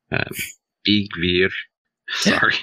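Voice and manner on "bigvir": Bigvir with "veer" as its ending; corrected pronunciation. Shown as a demonstration. Host retrying the servant's name, Bigvir ending in a long "veer". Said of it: "Anglis-, anglicized".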